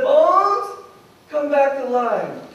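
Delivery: loud, high summons to the bones; ringing command to dead bones; commanding call. Acted. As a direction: call them up like a prophet.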